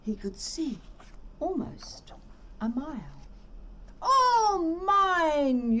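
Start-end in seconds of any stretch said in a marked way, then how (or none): 4.0-5.8 high, proud cry of ownership; gleeful cry. A narrator's reading. Yertle the turtle crying out over his new kingdom, gleeful. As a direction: crow it out like a greedy king. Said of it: "Yertle cried".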